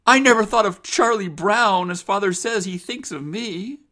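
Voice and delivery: put-on high, protesting girl's voice; distressed daughter. Rose protests to her mother, high and upset.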